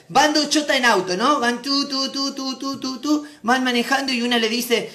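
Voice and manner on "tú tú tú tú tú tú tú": higher, even tooting; playful repeated engine toots; car noise imitation. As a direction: toot along like a little car. Imitates car driving along.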